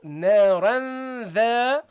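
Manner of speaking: slow chanted "naran the"; demonstrated recitation. Shown as a demonstration. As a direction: draw out "naran the" in measured recitation. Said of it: "So you go".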